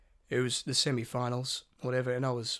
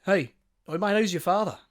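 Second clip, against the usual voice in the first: louder, higher stranger hailing someone; imitated calling out; 4 semitones higher, 5 dB louder than usual. Bus stranger pestering a passenger who is not listening, calling out. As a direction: call out to get his attention. Stranger calls out to Joel, retold.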